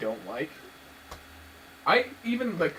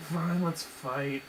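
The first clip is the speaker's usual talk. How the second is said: lazy drawl; 4 dB quieter, much slower than usual. Retold or imitated. Imitating lazy Spike.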